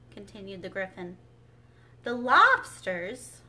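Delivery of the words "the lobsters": loud, slow rising question; questioning outcry. A narrator's reading. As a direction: ask "lobsters" loudly, drawn out. Misread line before shouted retake.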